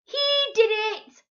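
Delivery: very high, loud, slow squawk; shouting parrot. A narrator's reading. Parrot squawks to blame Pete, very high loud shout. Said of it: "Shout it".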